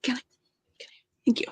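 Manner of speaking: unvoiced whispered aside; whispering. Real, not acted. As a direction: breathe it quietly.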